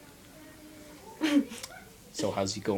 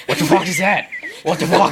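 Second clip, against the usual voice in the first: shocked shout; 7 semitones higher, 7 dB louder than usual. Real, not acted. Startled by light, shouting.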